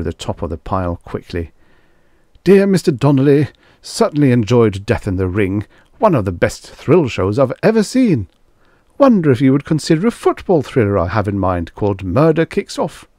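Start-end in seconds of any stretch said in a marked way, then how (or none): 2.4-13.1 a gushing fan, pitched up a bit; eager fan letter writer. A narrator's reading. A fan writing to the producer, eagerly. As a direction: read it like a keen fan pitching an idea.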